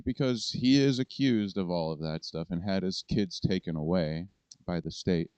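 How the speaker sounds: ordinary talk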